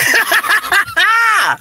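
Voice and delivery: a burst of high laughter; real giggling laugh. Real, not acted. Laughs after getting sidetracked.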